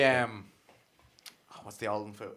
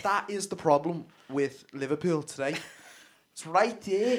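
higher, louder Scouse pundit rant; Jamie Carragher; 6 semitones higher, 3 dB louder than usual. Retold or imitated. Carragher impression on Liverpool, a worked-up Scouse rant. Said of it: "Jamie Carragher impression".

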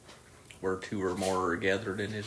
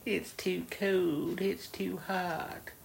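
higher, slow mock whine; whiny excuse voice; 7 semitones higher, slower than usual. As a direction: drag out silly excuses, whining. Mimicking customers' excuses.

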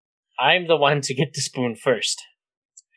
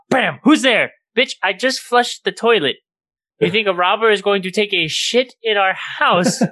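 higher, louder mock Dad yelling; mock angry dad; 8 semitones higher, 5 dB louder than usual. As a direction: ham up Dad's outraged challenge. Dad confronting the toilet noise.

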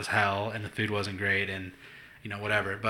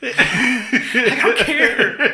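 much higher, louder, very slow stretch; laughing protest; 13 semitones higher, 8 dB louder, much slower than usual. Retold or imitated. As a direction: wail it out through laughter.

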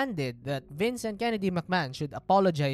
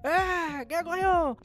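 very high, strained betrayed shouting with a cry; berserk rant; 11 semitones higher than usual. Acted. Bret raging backstage at the company that betrayed him, high strained furious rant. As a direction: rage like a betrayed man trashing the room. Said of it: "talagang nagwawala".

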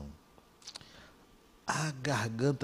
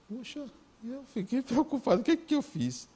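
startled worry; 11 semitones higher than usual. Retold or imitated. Worried on being accused.

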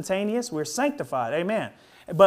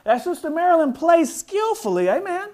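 fervent high, loud preaching; 6 semitones higher, 6 dB louder than usual. Acted. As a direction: preach it with rising fire. Preaching at full force.

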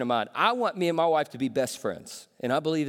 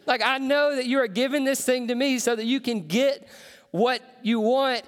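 amused busting; 7 semitones higher than usual. Retold or imitated. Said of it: "I'm like".

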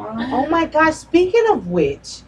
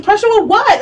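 higher-pitched baffled retort; incredulous; 7 semitones higher, 4 dB louder than usual. Retold or imitated. Retelling her challenge to a nervous partner, high and incredulous. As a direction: throw the question back in disbelief.

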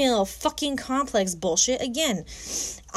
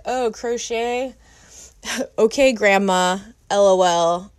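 slow, louder condescending tease; mocking imitation; 5 semitones lower, 3 dB louder, slower than usual. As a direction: mimic his smug dig at crochet. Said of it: "And then he was like".

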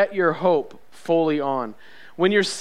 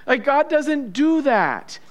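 usual speaking voice

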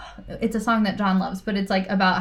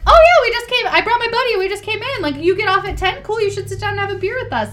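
high, eager, casual guy voice; imitating the boyfriend; 12 semitones higher, 4 dB louder than usual. Future husband inviting her for a beer, high and eager. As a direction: play the hopeful regular, high and bouncy. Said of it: "he would just be like".

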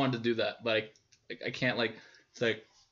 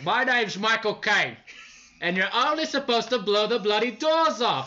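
loud, high, drawn-out Cockney impression; Michael Caine; 9 semitones higher, 5 dB louder, slower than usual. Comic showing his only impression, loud Cockney actor. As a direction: belt it out as an exaggerated London film star. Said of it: "I can only do the Michael Caine impression".

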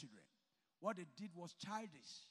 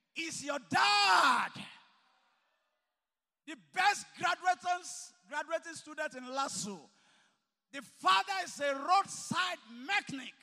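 loud, high, slow shouted sermon; preaching at full force; 6 semitones higher, 11 dB louder than usual. Acted. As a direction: hammer each phrase out to the hall. Preacher shouting about graduate's father.

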